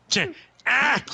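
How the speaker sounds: high mock scream; meme scream effect. Describing a meme scream to co-host, high mock yell. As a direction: imitate the screaming meme sound. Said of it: "that sound effect of someone screaming".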